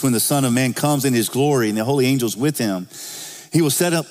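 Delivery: preacher proclaiming a quoted passage; scripture reading. Acted.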